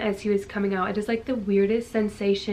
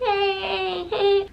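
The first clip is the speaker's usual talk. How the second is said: mock cheer; 10 semitones higher than usual. Husband cheering over baby.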